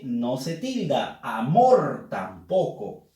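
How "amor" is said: "Amor" drawn out on a strong MOR; teacher showing the stressed MOR in "Amor". Shown as a demonstration. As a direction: lean slowly into the end of "Amor". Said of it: "Última sílaba con la fuerza de voz".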